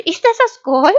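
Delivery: high, loud sheep question; curious sheep. Acted. Sheep spotting the wolf's gold watch, curious bleat. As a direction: bleat it out in wonder.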